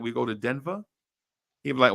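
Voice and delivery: ordinary talk, nothing marked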